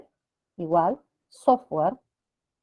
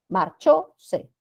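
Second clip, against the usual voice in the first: "Marchó, se" with CHÓ raised, then "se" dropped; stress on CHÓ; much slower than usual. Shown as a demonstration. Teacher showing students a grave word, stress on CHÓ in "Marchó, se". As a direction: punch CHÓ in "Marchó, se", let "se" fall away. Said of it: "Grave que termina en vocal".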